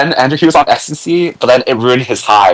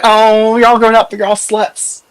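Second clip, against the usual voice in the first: mock sweet teasing; 8 semitones higher than usual.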